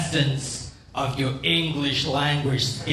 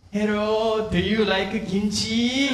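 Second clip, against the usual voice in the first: higher-pitched hammed-up Korean accent; put-on Korean accent; 5 semitones higher than usual. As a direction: greet in a high, thick Korean accent. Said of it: "walk up to this person and say".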